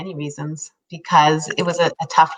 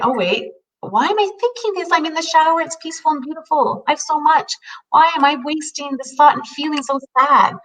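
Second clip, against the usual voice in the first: questioning inner voice; 9 semitones higher than usual. Retold or imitated.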